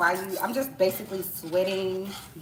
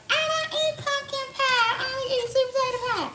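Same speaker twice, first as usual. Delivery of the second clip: much higher prissy voice, slowing down; mocking picky eater; 3 dB louder than usual. Mimicking pie snobs in a high prissy voice. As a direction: whine it like a fussy guest. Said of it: "Or the people that's like".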